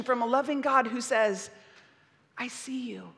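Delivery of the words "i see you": God's gentle voice. Pretend. A loving God who says.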